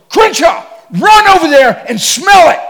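loud, high barked command; shouted order. Retold or imitated. Guide ordering the preacher, loud shouted command. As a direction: yell it like a pushy safari guide.

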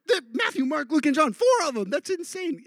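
high, hammed-up amazement; giddy, high-pitched mock zeal; overexcited new convert. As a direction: blurt it out like astonishing news. Retold gushing of new convert.

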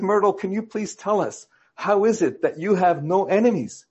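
high-pitched, eager questioning; an excited preacher seizing a teaching moment; excited pastor. Acted. Pastor presents Myrtle to the congregation, excited. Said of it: "he got all excited".

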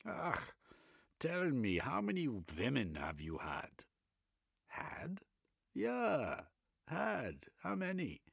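put-on Reich in a German accent, eagerly expounding.

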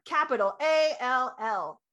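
slow, loud letter-by-letter emphasis on A L L; emphatic spelling. Shown as a demonstration. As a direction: punch each letter of A L L, drawn out. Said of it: "we give it all to them".

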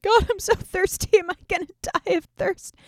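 hammed-up parched complaint, pleading upward; whiny thirsty Samson.

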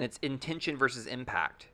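usual speaking voice

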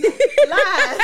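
loud laughing echo. Real, not acted. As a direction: laugh it out, high.

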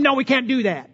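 slightly exaggerated imitation of a snarky attendant; hammed-up snippy no. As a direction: refuse the customer with mock snark. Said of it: "I'm exaggerating just a little bit".

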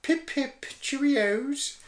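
very high, posh falsetto; mock British royalty. Co-host as the Queen's ghost, high posh falsetto. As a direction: sign off like a squeaky royal.

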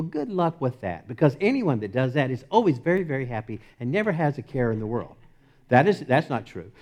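normal talking voice

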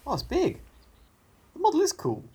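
high amazed delight. Real, not acted. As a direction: marvel at the model. Amazed at the model.

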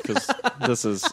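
ordinary talk, nothing marked

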